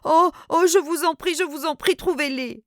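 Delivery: high, loud anguished pleading; cry of suffering. A narrator's reading. Cousin begging the narrator to find the money, anguished cry. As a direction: beg desperately, voice cracking upward. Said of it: "une sorte de cri de souffrance".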